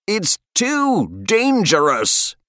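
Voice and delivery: high, drawn-out warning shout; alarmed shout. A narrator's reading. Rider warning his reckless friend, shouting high. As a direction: shout it over thundering hooves. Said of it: "Fenton shouted above the hoofbeat thunder".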